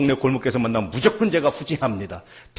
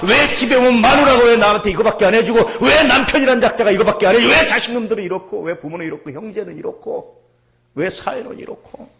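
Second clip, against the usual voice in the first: loud, higher-pitched mock grievance; indignant complaints; 8 semitones higher, 8 dB louder than usual. Preacher voicing spouses' grievances at home, loud indignation. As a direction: reel off complaints in an aggrieved outcry. Voices family members' resentful complaints.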